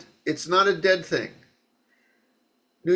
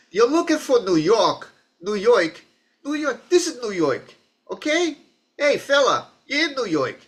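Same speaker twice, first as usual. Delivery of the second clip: put-on old-time New York accent, pitched high; punchy, high-voiced old New York accent; father's old New York accent; 7 semitones higher than usual. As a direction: talk like an old-school New Yorker. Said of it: "this is how my father talked".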